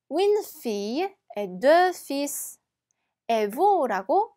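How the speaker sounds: slow, careful French model sentence; slow teacher's French read-aloud. Shown as a demonstration. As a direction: read it slowly like a language tape. French dialogue line, slow.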